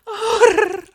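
a sharp, loud "oh" that bursts out; sudden loud moan. Acted. Catgirl stuck in a window, moaning loud to her partner.

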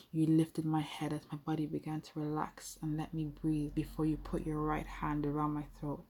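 usual speaking voice

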